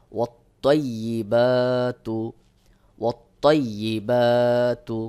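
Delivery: slow recitation with heavy t and long "বা"; tajweed recitation. Shown as a demonstration. Teacher models for learners, slowly recited. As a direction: hold the "বা" in ওয়াত তৈয়িবাতু.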